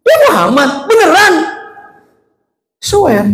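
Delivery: high-pitched astonished questions; stunned disbelief. Acted. Blind man learning his feeder was Muhammad, stunned. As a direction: ask high, unable to believe it.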